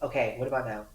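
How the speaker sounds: echoing like in a hallway; far-off voice in a corridor. Real, not acted. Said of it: "now you're in a hallway".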